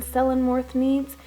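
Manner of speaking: usual speaking voice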